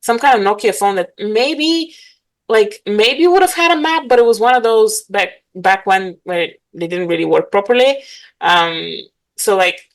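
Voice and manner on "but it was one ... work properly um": ordinary delivery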